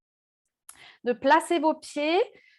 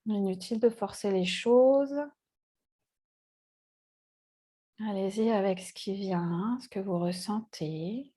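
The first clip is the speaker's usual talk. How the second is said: guided meditation; 4 semitones lower than usual. Acted. Yoga teacher leading seated students into body awareness, calm meditative guiding. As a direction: guide them calmly into the breath, with long pauses.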